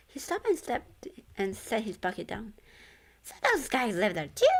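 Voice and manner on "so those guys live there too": a higher, louder creature voice; squeaky cat-monster voice; Grim's voice. A narrator's reading. Grim asking the ghost about dorms, higher and louder. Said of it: "Grim asked".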